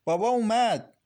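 excited high, loud shout; loud call. A narrator's reading. Milad announces that father has come, loud call. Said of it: "با صدای بلندی گفت".

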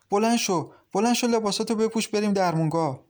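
high-pitched, hurried order to get up; urgent urging. A narrator's reading. Girl urging the sick woman to the clinic, urgent urging. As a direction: hurry her along, mildly insistent.

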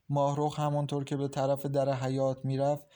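ordinary delivery, nothing marked

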